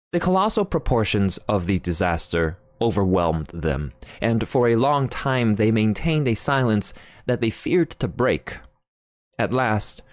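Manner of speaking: ordinary delivery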